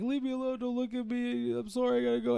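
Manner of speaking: high, soft mock-tearful whine; imitated crying. Bullied boyfriend begs to be left alone, mock crying. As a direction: plead in a high, fake-crying voice. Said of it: "he starts crying".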